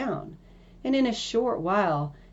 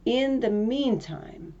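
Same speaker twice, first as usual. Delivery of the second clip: ordinary delivery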